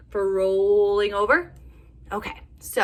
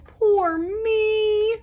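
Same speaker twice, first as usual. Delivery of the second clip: high, crying wail; 6 dB louder than usual. Acted. Lost Bear huddled by a tree, high crying wail. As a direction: whimper it like a lost little bear. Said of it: "he wails".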